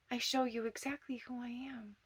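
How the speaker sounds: usual speaking voice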